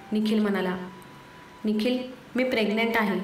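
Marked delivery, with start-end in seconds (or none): none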